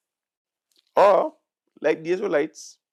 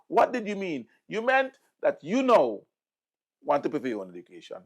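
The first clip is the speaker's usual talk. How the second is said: ordinary talk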